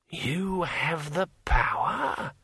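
put-on an old dead man querying word by word; drawn-out, rising old-man question; brittle old voice. A narrator's reading. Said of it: "in a brittle old voice".

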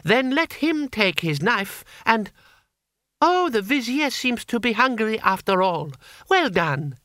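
put-on high, silky-polite child ruler; high-pitched imperial mockery; menacing boy Emperor. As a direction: threaten through courtly politeness.

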